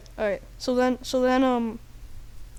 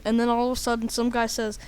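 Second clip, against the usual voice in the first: ordinary delivery, nothing marked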